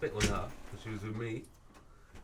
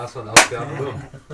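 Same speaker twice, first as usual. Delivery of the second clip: much louder, calling out to a mate; celebratory yell to end the show; loud shout-out; 3 semitones higher, 15 dB louder, slower than usual. Real, not acted. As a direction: hype up Oscar with a big shout. Shouting out a friend.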